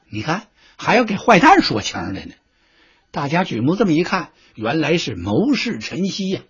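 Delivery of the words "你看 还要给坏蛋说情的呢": ordinary delivery, nothing marked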